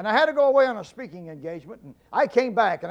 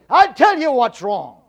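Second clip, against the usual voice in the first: louder, higher, slow furious outburst; angry rant; 6 semitones higher, 8 dB louder, slower than usual. Retold or imitated. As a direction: let the husband blow up at the stereo. Angry husband rants at wife.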